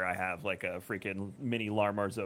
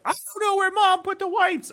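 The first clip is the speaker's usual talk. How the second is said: put-on high, loud helpless boy; whiny kid; 8 dB louder than usual. Dad imitates his son, high and loud, unable to find the wipes. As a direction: whine it like a lost kid. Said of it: "he's like".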